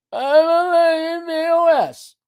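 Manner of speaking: high, mangled mimicry of the viewer; mock repeat-after-me. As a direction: parrot it back in a silly high voice.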